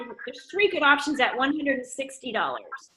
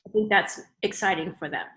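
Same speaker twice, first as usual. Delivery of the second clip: ordinary talk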